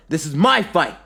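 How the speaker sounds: high, loud, possessive yell; forceful high-pitched shout. A narrator's reading. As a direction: shout it, claiming the fight. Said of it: "then he yells".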